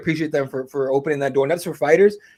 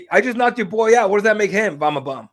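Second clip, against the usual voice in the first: mock yell, pitched higher; retold shout back; 6 semitones higher than usual. Fighter shouting back at hecklers, mild mock yell. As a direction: call it out across the arena. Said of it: "just yells out".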